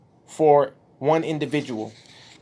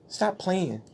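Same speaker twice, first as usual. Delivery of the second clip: ordinary talk, nothing marked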